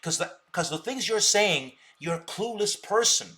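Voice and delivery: ordinary delivery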